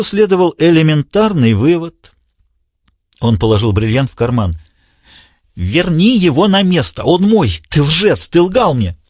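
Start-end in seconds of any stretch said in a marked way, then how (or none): 5.5-9.0 high, furious madman's demand; enraged villain. A narrator's reading. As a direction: hurl the accusation at him. Diogenes voiced high.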